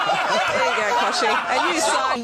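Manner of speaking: anchor stifling giggles. Real, not acted. Anchor laughs at Kochie remark.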